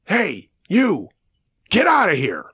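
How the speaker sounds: high, loud shout to chase someone off; yelling out. A narrator's reading. Dad shouting at a hairy man in the woods, yelling out. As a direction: yell it hard into the distance. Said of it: "I heard my dad yell out".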